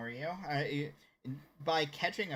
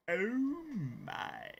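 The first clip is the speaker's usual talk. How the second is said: George Takei; 7 semitones higher than usual. Acted. Streamer shows viewers a George Takei impression, swooping drawn-out catchphrase. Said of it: "I could totally go as George Takei".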